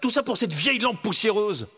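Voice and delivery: high, sarcastic scolding; exasperated Aladdin. Acted. Aladdin blames his monkey for trapping them, exasperated. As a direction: scold with bitter mock congratulations.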